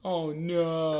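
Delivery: drawn-out "oh no"; mock dismay. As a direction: play along with exaggerated sympathy. Mock dismay at soap-opera gossip.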